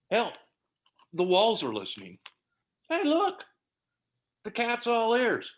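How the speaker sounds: sarcastic. Acted. Husband mocks everyone for listening.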